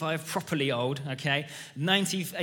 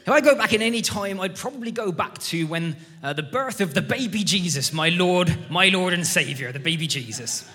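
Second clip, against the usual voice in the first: put-on loud countryside old boy; old churchgoer's rustic accent, proclaimed loudly and higher at the start; 3 semitones higher, 6 dB louder than usual. Old boy answering the pastor's question in church, hammed-up countryside accent. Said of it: "should do a countryside accent".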